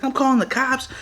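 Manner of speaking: high mock female voice; imitated sister's threat. Sister threatening the gunman with police, high imitated voice. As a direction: mimic her warning up high.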